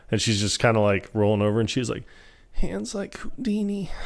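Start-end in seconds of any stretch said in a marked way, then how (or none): 2.5-3.9 high, soft, slow mock murmur; waking up groggy. Woman rolling over as she wakes, acted groggy murmur. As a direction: drift the words out as if half asleep. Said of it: "when she's waking up".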